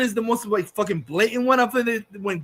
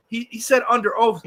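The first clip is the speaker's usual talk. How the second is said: usual speaking voice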